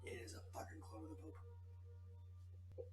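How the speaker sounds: slow hushed whisper. Real, not acted. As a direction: breathe it out in shock. Player whispers realization.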